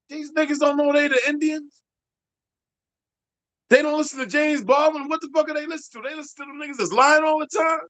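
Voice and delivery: incredulous, high-pitched mock outrage; lightly voiced New Yorkers scoffing; imitating pale skins. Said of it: "pale skins talking like this".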